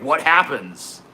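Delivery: ordinary talk, nothing marked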